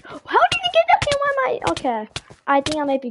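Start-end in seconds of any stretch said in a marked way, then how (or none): 0.1-1.7 surprised. Real, not acted. Gasps at opponent's item mid-game.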